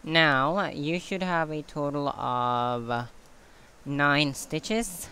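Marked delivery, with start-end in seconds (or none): none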